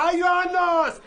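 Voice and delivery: calling out to crowd. Acted. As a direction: shout for everyone to hear. Seller calls out to bazaar crowd.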